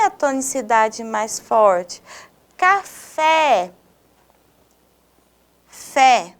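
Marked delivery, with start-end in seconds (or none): 2.6-3.7 "Café" with a long, stressed final "fé"; stress on last syllable. Shown as a demonstration. Teacher showing students an oxytone, stressed "fé" in "Café". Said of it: "Fé é a sílaba da palavra café". 5.7-6.3 "Fé" said alone and firmly; stressed syllable alone. Shown as a demonstration. Teacher isolating the strongest syllable of café, "Fé" stressed. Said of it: "Fé é a sílaba da palavra café com mais tonicidade".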